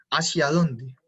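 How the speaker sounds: ordinary talk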